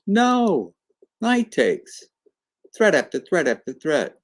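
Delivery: high, emphatic "no" then punchy phrases; excited retort. Acted. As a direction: act out a triumphant chess counterattack. Acted retort of surprise move.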